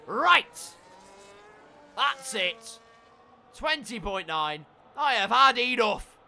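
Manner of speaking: loud, high exasperated shouts; fed-up outburst. Pretend. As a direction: explode as if you've had enough.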